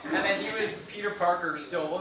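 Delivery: ordinary delivery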